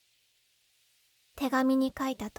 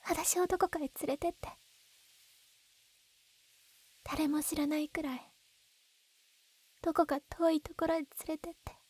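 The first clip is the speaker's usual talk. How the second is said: soft pleading; 2 semitones higher, 5 dB quieter than usual. Acted. Begging him to run away.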